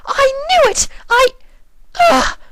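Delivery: very high and loud, then a pained ugh; Rarity's cry. Acted. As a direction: triumph cut short by pain. Rarity shouts then falters.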